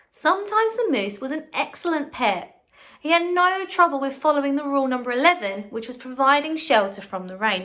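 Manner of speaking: put-on bright, lively read-aloud to children; story reading. A narrator's reading. Teacher reading a picture book to her class, bright and lively.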